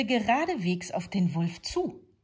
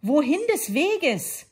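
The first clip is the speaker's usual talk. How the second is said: higher, louder, slow, puffing wolf; breathless slow wolf call; out of breath; 10 semitones higher, 8 dB louder than usual. A narrator's reading. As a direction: gasp it out after running to catch up. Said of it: "ganz schön außer Atem".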